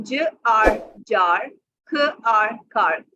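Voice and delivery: ज आर जार, sounds drawn out very slowly; slow sounding out. Shown as a demonstration. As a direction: stretch ज and आर, then blend जार. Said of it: "one sound के तौर पे". (बहुत धीरे ध्वनियाँ जोड़ना)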